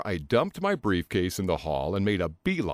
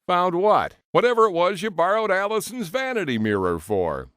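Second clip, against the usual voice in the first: raised exchange; 10 semitones higher, 5 dB louder than usual. A narrator's reading. Bathroom door banter.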